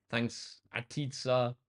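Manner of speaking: ordinary talk, nothing marked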